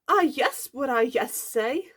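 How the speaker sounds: high, gushing, flustered yes; overjoyed, loud swooning; thrilled gasp. A narrator's reading. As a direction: gasp and squeal the acceptance. Girl asked out by crush.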